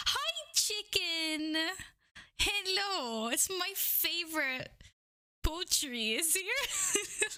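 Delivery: high, slow sing-song hello; squeaky slow greeting to a favorite viewer; cute greeting voice. Acted. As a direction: coo at the chicken like a pet.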